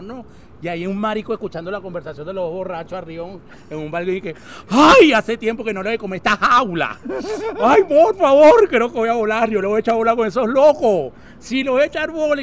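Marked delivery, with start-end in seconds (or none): 4.7-12.4 loud, high, swishy camp voice. Acted.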